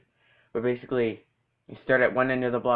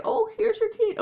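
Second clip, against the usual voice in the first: put-on much higher fussy old voice. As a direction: mimic a dawdling neighbor.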